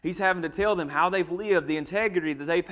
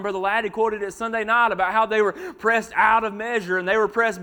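louder, driving sermon delivery; fervent preaching; 4 semitones higher, 5 dB louder than usual. Acted. Preacher recounting Paul's trials to the church, building intensity. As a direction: press each point home to the pews.

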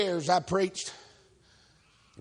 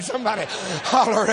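ordinary talk, nothing marked

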